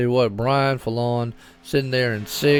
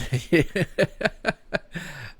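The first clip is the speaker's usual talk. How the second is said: soft laugh; 4 semitones higher, 4 dB quieter than usual. Real, not acted. Laughs at own yawning.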